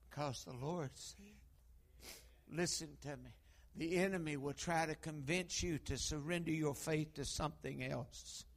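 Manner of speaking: ordinary talk, nothing marked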